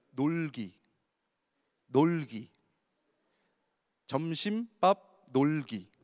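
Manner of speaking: each item drawn out slowly; slow listing. Shown as a demonstration. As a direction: list the schedule item by item, slowly. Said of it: "진짜 이렇게 짰어요". (천천히 하나씩 늘어놓듯)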